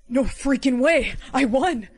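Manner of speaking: excited shout. Acted. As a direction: yell like you just won big. Winning the lottery ticket.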